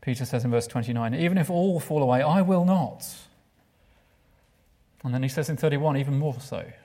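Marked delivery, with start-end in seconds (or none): 1.1-3.0 raised, louder, defiant vow; high confident promise; Peter's bold boast. Acted. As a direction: declare it like a proud disciple. Peter's boast voiced in sermon.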